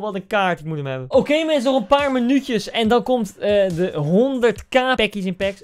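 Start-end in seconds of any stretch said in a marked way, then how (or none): 1.1-5.6 high, loud countdown hype; rally the viewers loudly; excited hype. Real, not acted. Gamer tells viewers packs come in minutes, excited hype.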